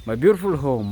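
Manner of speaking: loud, proud showing off of the house; proud exclaim. Real, not acted. Vlogger showing viewers his home, loud and proud. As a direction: belt it out proudly.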